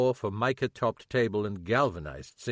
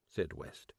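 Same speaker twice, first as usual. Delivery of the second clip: ordinary delivery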